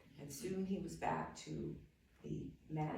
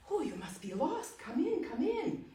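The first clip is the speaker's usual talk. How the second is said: put-on higher, kindly old host's voice; old man's welcome; 10 semitones higher, 4 dB louder than usual. Old man welcoming the lost young man, higher and kindly.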